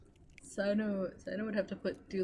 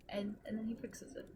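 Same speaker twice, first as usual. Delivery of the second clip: normal talking voice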